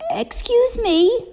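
tiny high whispery bird voice; small bird, timid and slow; sparrow voice. A narrator's reading. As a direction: sparrow politely interrupting. Sparrow speaks to Toad.

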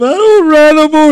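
high, loud wailing kid imitation; screaming child. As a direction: holler it like a boy who has had enough. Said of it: "He's screaming and hollering".

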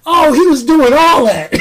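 loud, high burst of hype; excited exclamation. Real, not acted. Reviewer hyped over a movie fight, excited shout. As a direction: blurt it out, thrilled by the moves.

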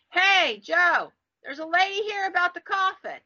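bartender calling into another room; imitated yell. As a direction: raise pitch and volume to call someone next room. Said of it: "he yells into this other room".

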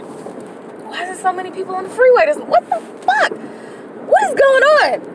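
can't believe the crowded freeway; high incredulous outcry; shocked disbelief. Real, not acted.

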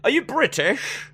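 put-on British accent, lilting high. Shown as a demonstration. Co-host asks for it, putting on British accent. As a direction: perform a hammy British accent. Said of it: "do your British accent again".

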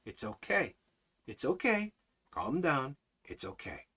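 put-on softly calming a worked-up child; gentle, hushed reassurance, easing down; soothing parent. Parent to a worked-up child, soothing and soft. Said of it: "what does the parent say?".

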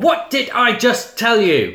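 loud, high-pitched telling-off; mock scolding. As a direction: scold him in hammed-up exasperation. Acted scolding of the partner.

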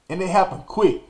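normal talking voice

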